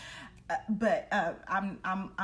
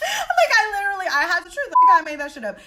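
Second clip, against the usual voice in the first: loud, high and excited; 9 semitones higher, 12 dB louder than usual. Real, not acted. Confessing to the audience that she made it up, loud and excited. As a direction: gush it out, bursting.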